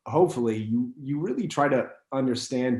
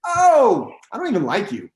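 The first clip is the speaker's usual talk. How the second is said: high, loud "Oh", then mock dismissal; disdainful recognition; 7 semitones higher, 6 dB louder than usual. Subway woman learning who actor is, disdainful. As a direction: imitate a woman put off on recognising someone. Woman rejects him, acted quote.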